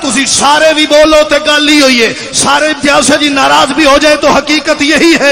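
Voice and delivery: shouted climax of the majlis; preach. Real, not acted. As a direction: declaim at full force, high and loud. (पूरे जोश से मजलिसी ख़िताबत)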